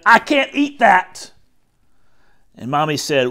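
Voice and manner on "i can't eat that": high, loud acted wail; crying protest. A narrator's reading. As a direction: protest like an upset child. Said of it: "I cried and I cried".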